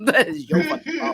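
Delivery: playful accusation bursting into laughs; laughing mock blame. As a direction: blame them in jest while cracking up. Retold blaming of dad, escalated repeat.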